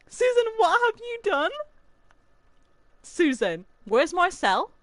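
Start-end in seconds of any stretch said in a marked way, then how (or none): 0.0-1.5 laughing terror. Real, not acted. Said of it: "I'm terrified".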